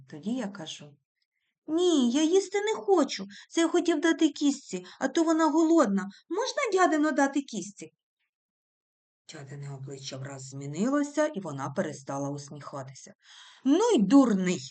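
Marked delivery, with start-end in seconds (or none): 1.7-7.9 higher, louder, with a cheeky edge; boy's bold reply. A narrator's reading. The boy answers his aunt, high and bold. 13.6-14.6 louder, curt scolding; angry retort. A narrator's reading. The aunt snaps angrily at the boy. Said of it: "каже вже сердито".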